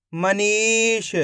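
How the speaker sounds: each syllable stretched out slowly; teacher slowly blends the syllables into a word for kids; slow blended word. Shown as a demonstration. Teacher to young pupils, blending syllables: slow, drawn out. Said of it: "तो मिलके बन जाएगा".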